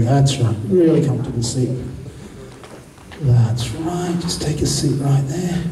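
low, soothing hypnotist patter; hypnotic induction. Acted. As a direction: lull them toward the chair. Hypnotic induction of next volunteer.

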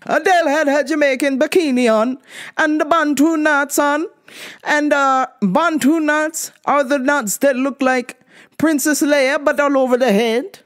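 put-on Jamaican accent. Half Jamaican host explains Bantu knots in a light Jamaican accent. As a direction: slip into a half Jamaican lilt. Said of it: "I should use my Jamaican accent".

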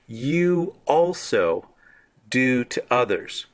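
emphatic slow also; stress on You also. Shown as a demonstration. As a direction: lift and stretch You also. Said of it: "should be translated as also".

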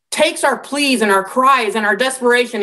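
usual speaking voice

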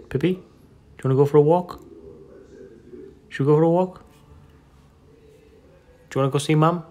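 high, sing-song coaxing of the dog; high pet talk. Real, not acted. Owner asking her pug about a walk, high sing-song voice. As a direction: lift the voice up high to get the pug excited.

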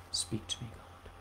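very soft plea, almost breathed; hushed prayer. Retold or imitated. Preacher retelling his prayer, very soft plea. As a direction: barely voice the request to God.

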